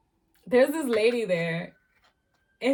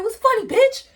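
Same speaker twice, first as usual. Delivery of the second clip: screaming what's funny at her, high and loud; angry yell; 13 semitones higher, 11 dB louder than usual. Retold or imitated. She yells at the lady laughing at her, furious.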